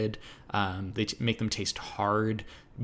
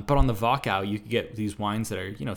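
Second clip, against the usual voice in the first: ordinary talk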